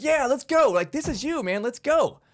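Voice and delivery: loud, high hyped-up cheer for the underdog; root for your team like a fan in the stands. Pretend. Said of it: "You're like".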